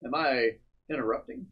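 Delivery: normal talking voice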